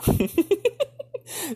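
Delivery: bursts into a high laugh at herself; a real laugh, high and breathy; laughing at the memory. Real, not acted. Laughs at own childhood idea.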